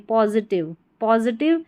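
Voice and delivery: "पॉजिटिव" ending in a short "tiv"; "पॉजिटिव" with a short I in the last syllable; correct pronunciation. Shown as a demonstration. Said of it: "T I वी को टिव पढ़ेंगे".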